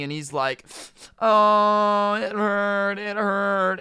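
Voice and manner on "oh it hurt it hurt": higher, louder mock-crying kid; mock whining cry. Spoiled kid mock crying after falling in the store. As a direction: whimper it like a hurt kid, hammed up. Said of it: "the kid gets up crying".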